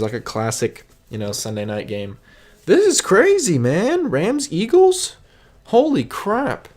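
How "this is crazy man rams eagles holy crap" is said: high-pitched, stunned excitement over the schedule; can't believe the matchups, voice shooting up; real excitement. Real, not acted. Vlogger reacts to Sunday night matchups, high-pitched excitement. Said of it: "I need to just calm down".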